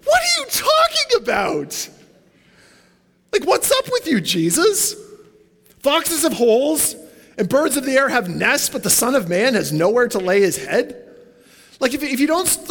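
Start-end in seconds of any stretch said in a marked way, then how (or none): 0.0-5.0 high, baffled cry of disbelief; incredulous outburst. Pretend. The confused follower reacting to Jesus, in high incredulous disbelief. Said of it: "You're kinda like". 5.8-11.0 put-on higher, incredulous echo of Jesus' British-accent words, rising like a question; baffled repeat. The would-be follower repeating Jesus' words back, bewildered and rising. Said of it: "You're kinda like".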